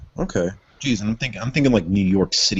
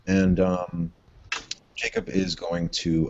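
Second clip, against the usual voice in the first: ordinary talk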